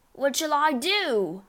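high, drawn-out panicked wail. A narrator's reading. Father begging family for help, wailing. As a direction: wail it helplessly. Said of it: "wailed the father".

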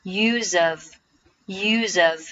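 "use of" very slow, the z sound sliding into "of"; slow linking demo of "use of"; linked pronunciation. Shown as a demonstration. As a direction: join "use of" into one drawn-out unit. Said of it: "Use和后面的of可以连读成".

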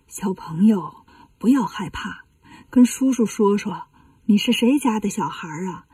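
put-on soft, soothing voice to a child; gentle reassurance. Monk questions a child hung in a tree, gentle reassurance. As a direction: coax a frightened child softly.

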